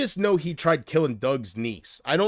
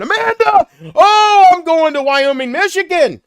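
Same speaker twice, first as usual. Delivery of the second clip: much higher, louder frantic scream; panicked screaming; 13 dB louder than usual. Retold or imitated. Mocking himself fleeing Nick Welsh, screaming in panic. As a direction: shriek it like you're running for your life. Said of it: "And I'm here going".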